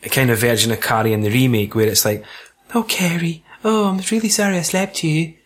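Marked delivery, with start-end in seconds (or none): 2.7-5.3 higher, softer, lightly voiced gushing teacher; a hint of a saccharine teacher; bleeding heart teacher. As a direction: coo it like an oversorry teacher. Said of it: "the bleeding heart liberal kind of version".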